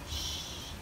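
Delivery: very soft shush; hushing. A narrator's reading. Squid passing the shark, hushing. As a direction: hush it, all breath.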